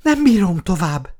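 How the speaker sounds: panting, high-pitched exhausted cry; winded and worn out, voice pushed up; out of breath. A narrator's reading. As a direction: gasp it out after running. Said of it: "kifulladva megállt".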